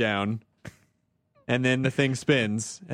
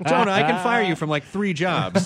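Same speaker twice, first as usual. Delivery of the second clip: high, drawn-out mock scolding; mock threat; 5 semitones higher, 4 dB louder, slower than usual. Boss threatening to fire Jonah, playfully indignant and high. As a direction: pull rank in mock indignation, slowly.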